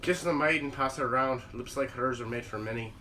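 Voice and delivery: put-on low, soft Irish accent poetry reading. Reciting Irish poetry to friends, Irish accent. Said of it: "doing it in a fucking Irish accent".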